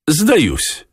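high-pitched cat voice, falling tone; high voice of a talking cat giving up; cat character. A narrator's reading. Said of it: "сказал кот".

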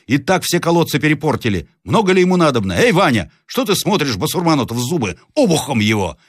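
high-pitched, agitated peasant egging on; impatient rough peasant. Acted. Red-haired peasant ordering Vanya to strike, impatient role voice. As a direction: goad your mate into action.

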